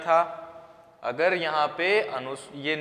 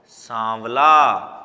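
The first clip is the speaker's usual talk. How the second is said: सांवला drawn out slowly and loudly; slow, clear demo; 4 semitones lower, 8 dB louder, much slower than usual. Shown as a demonstration. Teacher spells the word out slowly for the class. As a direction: stretch each part of सांवला. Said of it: "combined करके क्या बोलेंगे इसको?".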